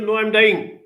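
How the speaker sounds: a lightly voiced little boy insisting he is dying; wailing child. Child wailing back to father.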